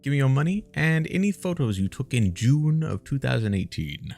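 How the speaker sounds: mock officer making demands; hammed-up Gestapo officer. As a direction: order it like a petty state enforcer. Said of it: "like a Gestapo".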